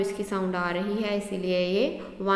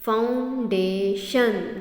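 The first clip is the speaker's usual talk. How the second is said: Foundation in three slow beats, "foun - day - shun"; split into syllables; 3 dB louder, much slower than usual. Shown as a demonstration. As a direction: break foundation apart, syllable by syllable. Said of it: "तीन part में टूट रहा". (Foundation को तीन हिस्सों में तोड़कर, धीरे)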